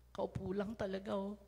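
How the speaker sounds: normal talking voice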